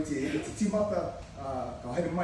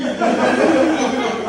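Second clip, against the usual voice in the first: hearty laugh; real laugh; loud laugh; 8 semitones higher, 13 dB louder than usual. Real, not acted. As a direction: crack up.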